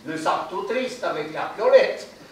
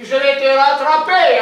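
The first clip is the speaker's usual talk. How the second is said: higher and louder playful yell; mock shout; 6 semitones higher, 7 dB louder than usual. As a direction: call out a teasing threat. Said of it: "Il dit".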